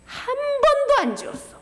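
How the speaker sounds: high, emphatic exclamation. Real, not acted. Preacher stressing to children, high and emphatic.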